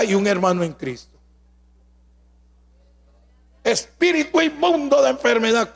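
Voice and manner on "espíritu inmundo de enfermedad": raised-pitch imitation of an exorcist; mock deliverance cry; mock showy healer. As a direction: lightly ham up a faith healer's command. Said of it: "comienzan a gritar puro show".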